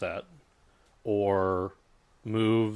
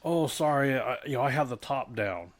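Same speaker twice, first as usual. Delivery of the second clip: higher-pitched mock apology from a caller; imitated caller; 5 semitones higher than usual. Convertible driver excusing the noise to an agent, higher-pitched imitated caller. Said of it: "And they're like".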